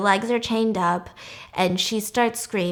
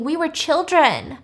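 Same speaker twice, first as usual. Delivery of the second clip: higher, drawn-out mock cry of protest; retold scream; 7 semitones higher than usual.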